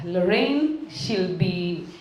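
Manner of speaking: ordinary talk, nothing marked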